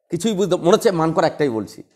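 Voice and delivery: usual speaking voice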